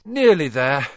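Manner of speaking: hauling the crate upstairs, panting. A narrator's reading. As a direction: gasp it while hauling a heavy crate. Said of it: "Harry panted".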